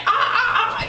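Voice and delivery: high staccato ah-ah-ah; mock pained yelps. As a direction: yelp as if hurting your hands, hammed up. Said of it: "like, hurting his hands and stuff".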